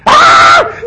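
high shrieking scream with a laugh; mock scream. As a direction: scream like a terrified sailor. Disciples screaming in the storm.

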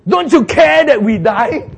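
slow, high, loud drawn-out complaint; wailing reproach. Acted. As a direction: stretch each word in loud dismay. Disciples blame Jesus.

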